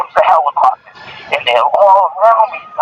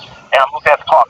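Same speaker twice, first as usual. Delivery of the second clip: usual speaking voice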